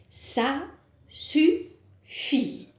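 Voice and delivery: slow, loud final punch; emphatic ending. Shown as a demonstration. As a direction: land the last line firmly. Climax of rhyme.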